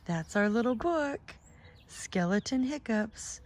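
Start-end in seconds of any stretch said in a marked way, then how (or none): none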